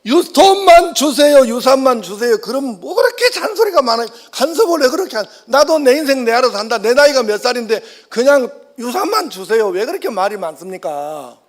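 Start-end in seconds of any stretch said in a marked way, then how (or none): 0.1-2.4 high, loud and slow whiny demand; bratty demanding son. Retold or imitated. Second son begging father for his inheritance, high whiny and loud. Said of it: "아버지에게 바라는 게 있어요". 5.5-9.7 higher, louder sulky rise on 나이가; defiant son. Retold or imitated. Second son talking back to father, higher and louder. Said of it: "둘째 아들은".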